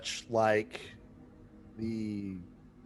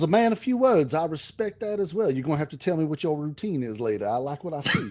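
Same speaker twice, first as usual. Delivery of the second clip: admiring abbot; 4 semitones higher, faster than usual. Acted. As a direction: gush over the strong silent type.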